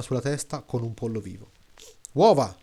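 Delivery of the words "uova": short, louder cry; narrated shout. A narrator's reading. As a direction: shout it as one sudden word. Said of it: "gridò la prima donna". (grido secco, a voce più alta)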